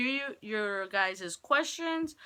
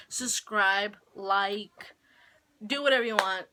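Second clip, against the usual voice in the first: ordinary talk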